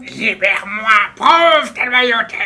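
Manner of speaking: high, loud, slow demand; commanding prisoner voice. Acted. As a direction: order him to prove loyalty. Captive demands release.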